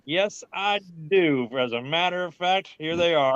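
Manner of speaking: retold reply, deliberately over-polite; pointedly obliging reply. Acting his ready answer to warden.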